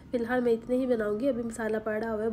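ordinary talk, nothing marked

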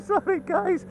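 sorry through a laugh; laughing apology. Real, not acted.

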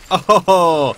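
ordinary talk

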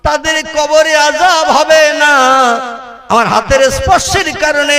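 high, loud, sustained chant; full-force melodic preaching. Acted. Preacher promising no torment in the grave, full-force preaching. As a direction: proclaim it in a soaring sermon tune.

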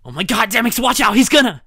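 loud, high, urgent yell; panicked warning shout. Acted. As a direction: scream the warning. Warning partner of danger.

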